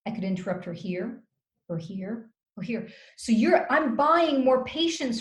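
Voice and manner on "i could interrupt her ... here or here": soft phrases, a small gap after "here"; spaced-out pauses. Shown as a demonstration. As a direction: pause before each "or here". Demonstrating gaps where interruption fits.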